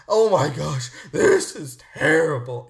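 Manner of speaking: loud, low, drawn-out mock horror; mock horrified complainer. Host mimicking complainers about atrocities, exaggerated horror. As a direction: imitate overwrought hand-wringing, slow and loud. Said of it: "they actually sounded like that a lot".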